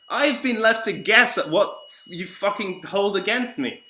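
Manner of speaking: loud, high, exasperated outburst; angry complaint. Real, not acted. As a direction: throw the grievance back at them.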